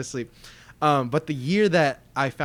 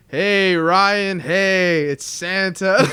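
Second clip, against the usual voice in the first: drawn-out, louder, half-hearted Santa greeting; low-effort Santa; 5 semitones higher, 8 dB louder, much slower than usual. Retold or imitated. Uncle in costume greeting his nephew, low-effort Santa. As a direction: imitate an uncle barely trying to be Santa. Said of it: "he's not gonna do an impression kinda guy".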